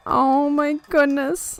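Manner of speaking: high, slow, melting coo; touched coo. Real, not acted. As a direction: draw it out high, melting with emotion. Melting at the cute moment.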